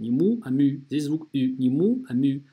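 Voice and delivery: usual speaking voice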